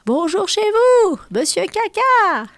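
very high, loud, slow booming call; voices from the sky. Acted. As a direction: call down from the heavens, stretched out. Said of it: "Du ciel lui parviennent des voix".

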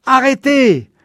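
high, loud screamed command; desperate scream. A narrator's reading. Father screaming at the mob hanging Mose, a desperate yell. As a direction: scream to stop them in desperation. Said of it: "hurla-t-il".

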